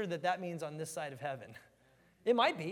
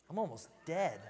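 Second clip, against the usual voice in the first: ordinary talk, nothing marked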